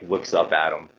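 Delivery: normal talking voice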